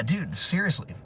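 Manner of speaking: ordinary delivery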